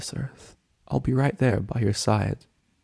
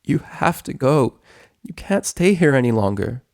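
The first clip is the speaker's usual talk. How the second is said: higher, louder, pained urging; urgent insistence; 5 semitones higher, 4 dB louder than usual. Acted. Twilight telling her dragon friend he must leave, urgent insistence. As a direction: insist through the heartache. Acted tearful parting, urging him away.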